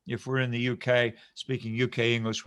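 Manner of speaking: usual speaking voice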